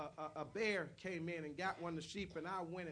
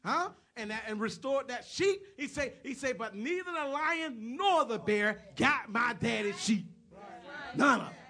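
full-force preaching; 6 semitones higher, 12 dB louder than usual. Acted.

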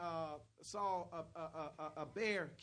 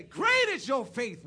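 loud, drawn-out preacher's proclamation; proclaimed praise; 7 semitones higher, 16 dB louder, slower than usual. Acted. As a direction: proclaim it slowly with full force.

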